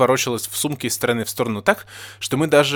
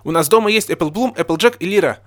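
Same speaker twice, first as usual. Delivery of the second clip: loud, high excited announcement; exclaiming; 7 semitones higher, 5 dB louder than usual. A narrator's reading. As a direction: blurt it out eagerly, higher and louder. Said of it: "воскликнул я".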